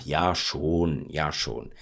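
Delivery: half-hearted polite agreement; reluctant yes. Shown as a demonstration. Teacher showing a polite half yes. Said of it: "So you say, ah".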